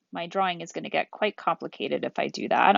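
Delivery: ordinary talk, nothing marked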